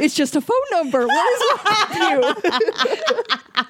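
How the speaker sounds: high, laughing protest; retold outburst breaking into laughter; mock exasperation. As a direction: protest in helpless disbelief, drawing out the end.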